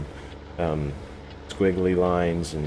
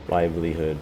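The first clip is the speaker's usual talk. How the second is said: normal talking voice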